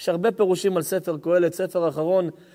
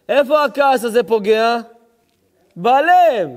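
loud preaching build; 5 semitones higher, 6 dB louder than usual. Acted. Builds to loud joking climax.

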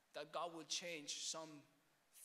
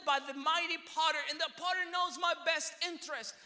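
very high, shouted revelation; fervent, soaring sermon cry; full-force preaching; 12 semitones higher, 17 dB louder than usual. Acted. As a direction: proclaim the breakthrough at the top of your voice.

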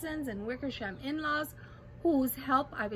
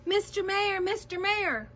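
urgent higher-pitched call; 7 semitones higher, 4 dB louder than usual. A narrator's reading. Elephant calling down to the mayor, calling out. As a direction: call to someone tiny far below. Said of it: "Horton called".

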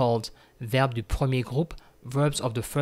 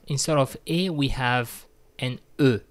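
normal talking voice

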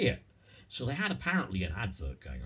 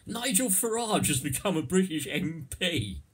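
mock announcement; 10 semitones higher, 5 dB louder than usual. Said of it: "you're pulling my leg saying".

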